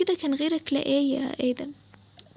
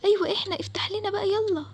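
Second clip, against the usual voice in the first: higher voices pleading from behind a door; desperate prisoners begging to be saved; trapped captives crying for help; 7 semitones higher than usual. Acted. Captives answer from inside. (أصوات محبوسين بتستنجد بيأس)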